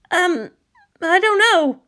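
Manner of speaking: sheepish parent. A narrator's reading. Embarrassed parent answering the police officer. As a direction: mumble an awkward non-answer.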